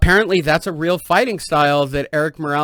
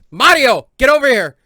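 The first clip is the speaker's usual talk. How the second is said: loud, higher call across the room; 7 semitones higher, 9 dB louder than usual. Real, not acted. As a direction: shout for Mario to come over. Calling out to Mario.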